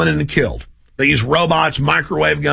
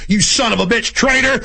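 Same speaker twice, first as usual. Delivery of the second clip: shouted, higher, like a brutal soldier; mock soldier yell; 6 semitones higher than usual. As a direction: bark the insult as a thug soldier executing someone. Acting out soldier executing citizen.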